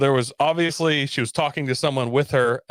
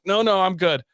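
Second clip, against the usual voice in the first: high, louder no-no, backing away; alarmed refusal; 8 semitones higher, 3 dB louder than usual. Retold or imitated. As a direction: recoil from her lice-filled head. Recoiling from lice in the hair.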